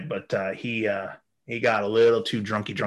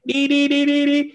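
very high, loud electronic beeping; imitated alarm; 7 dB louder than usual. Airport detector going off at his luggage, shrieking beeps. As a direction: screech out a repeating alarm.

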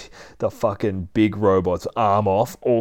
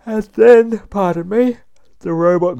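voice cracking; 12 semitones higher, 10 dB louder than usual. Real, not acted. Sudden squeak, then apology.

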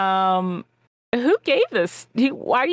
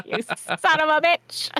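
higher, playful cursing; hammed-up insult between laughs; mock outrage; 7 semitones higher than usual. Player savouring an insult for the villain, mock outrage.

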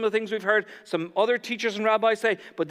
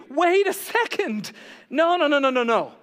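alarmed objection; 6 semitones higher, 3 dB louder than usual. Acted. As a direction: cut in as an outraged listener. Crowd objects to Jesus's words.

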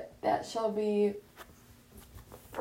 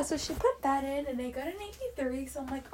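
ordinary delivery

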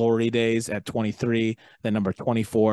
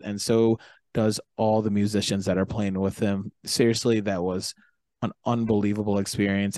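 ordinary delivery

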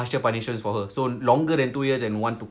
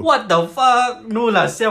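shocked; 9 semitones higher, 7 dB louder, slower than usual. Real, not acted. Shocked at the question.

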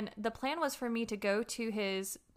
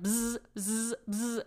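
buzzing like a phone going off; short vocal buzzes, one after another; imitated phone buzz; slower than usual. Woman mimics his phone going off, repeated buzzing.

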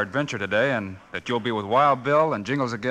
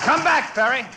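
much higher, louder yell to stop him; shouting after him; 10 semitones higher, 4 dB louder than usual. Acted. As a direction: call him back across the distance. Chasing fleeing outlaw.

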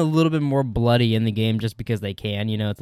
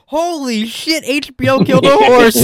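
high, loud mock outrage; hammed-up shriek of disbelief at the TV; imagined shocked outburst; 10 semitones higher, 7 dB louder, slower than usual. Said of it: "I really like to imagine she's watching the show and she's like".

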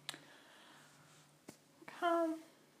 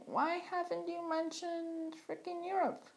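whiny listener; 8 semitones higher, 3 dB quieter than usual. Retold or imitated. Listener's imagined complaint.

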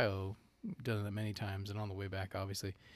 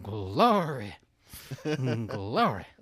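loud, swooping Southern "glory"; hammed-up Southern revival shout; mock Southern preacher; 6 dB louder, much slower than usual.

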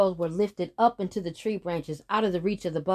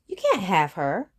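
put-on raised, firm boy's voice; firm refusal; 6 dB louder than usual. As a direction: stand firm and say no. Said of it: "answered the brother".

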